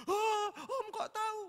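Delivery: put-on high, loud, slow sobbing boy's voice; hammed-up teen boy asking between sobs; crying teenage nephew. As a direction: mimic the startled weeping nephew. Nephew voiced crying in sermon story.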